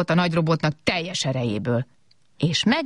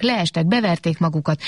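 usual speaking voice

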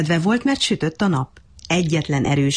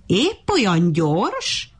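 mocking, laughing; 4 semitones higher, much slower than usual. A narrator's reading. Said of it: "a hasát fogta nevettében".

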